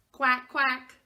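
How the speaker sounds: loud quacking call; calling duck. Acted. Parent reading to a child, duck calls loudly. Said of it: "called the duck".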